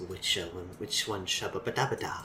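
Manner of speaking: low, hushed flashback voice; a far-off memory voice, dropped low; distant flashback echo. Acted. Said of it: "let me do all the flashback voices".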